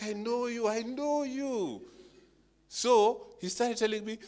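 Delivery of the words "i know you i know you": high, slow drawn-out I know you; knowing tease. Retold or imitated. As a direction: stretch it out, mock-knowing. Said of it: "I'm looking at him like".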